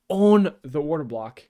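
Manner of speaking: excited call. Real, not acted. Trader watching the doji close on his order block, excited call. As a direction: punch it out like a trader watching his level hold. Price hits his level live.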